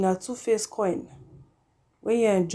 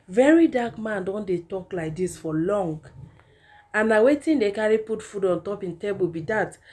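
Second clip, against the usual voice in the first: ordinary talk, nothing marked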